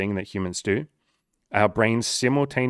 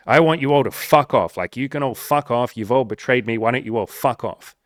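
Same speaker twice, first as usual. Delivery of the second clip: loud, raised mock rant at the world; angry outburst; 2 semitones higher, 6 dB louder than usual. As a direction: yell it like a hurt sulker. Ego tells world to fuck off.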